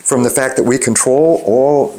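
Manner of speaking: ordinary talk, nothing marked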